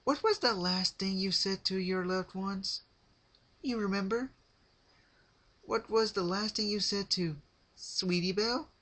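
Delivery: high, loud chirpy character voice with sad undertone; Pinkie Pie voice. A narrator's reading. Pinkie telling Rarity about her father, high chirpy voice. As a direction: chatter on cheerfully about something sad.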